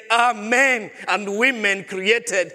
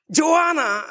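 calling daughter sternly; 8 semitones higher than usual. Retold or imitated. Father calling crying daughter.

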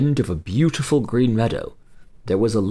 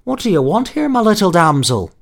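high, kindly little man; friendly voice; 9 semitones higher, 5 dB louder than usual. Acted. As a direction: greet her like a friendly elf. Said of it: "he said with a friendly voice".

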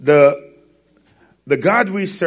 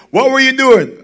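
much higher, louder demanding question; mother demanding to know where the money went, angry; 10 semitones higher, 7 dB louder than usual. Retold or imitated. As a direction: confront the kid like a furious mom. Mother confronting child.

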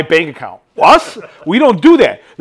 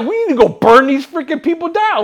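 retold anger; 8 semitones higher, faster than usual. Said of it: "I was so angry".

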